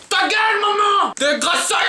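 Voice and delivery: put-on high shrill-kid shout at mom. Bratty kid yelling at his mother.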